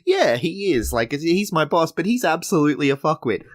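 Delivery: high imitation of a cheeky agreement; playful imitation of Justine; Justine's delivery. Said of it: "Justine's delivery of that".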